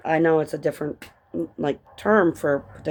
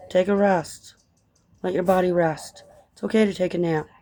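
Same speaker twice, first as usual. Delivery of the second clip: ordinary talk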